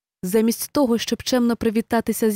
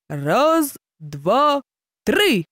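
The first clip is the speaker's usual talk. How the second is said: higher, punchy count-off; exclaimed count; 3 semitones higher, much slower than usual. Acted. Hare counting off the race start, exclaiming. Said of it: "вигукнув заєць".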